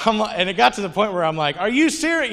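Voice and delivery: can't believe it's happening again; exasperated disbelief. Retold or imitated. Exasperated at the blowing receipt.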